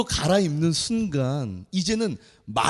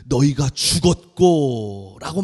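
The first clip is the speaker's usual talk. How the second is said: slow verse quote; 4 semitones lower, 3 dB louder, slower than usual. Shown as a demonstration. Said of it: "quoting the verse".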